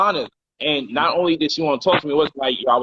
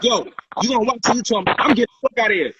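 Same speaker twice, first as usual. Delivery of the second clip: furious threat; 6 semitones higher, much faster than usual. Retold or imitated. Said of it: "She's like".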